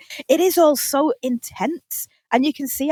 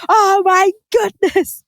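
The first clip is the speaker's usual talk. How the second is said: high, drawn-out mock amazement; big astonished reaction; 9 semitones higher, 5 dB louder, slower than usual. Recalling reaction to a TV twist, astonished cry. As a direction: stretch it out, high and aghast. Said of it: "the biggest reaction I've had to a T V show".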